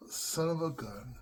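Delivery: soft, slow inner thought; quiet private thought. Retold or imitated. Boy thinking it to himself as the car leaves, soft and slow. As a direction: say it softly and slowly to yourself. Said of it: "I literally had the thought".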